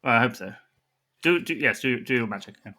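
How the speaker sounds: ordinary delivery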